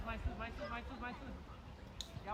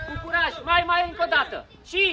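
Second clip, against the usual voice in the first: raised, louder coaching call; urging shout; 3 semitones higher, 6 dB louder than usual. Real, not acted. Coach spurs a young player to try once more, urging shout. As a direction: call out encouragement over the pitch.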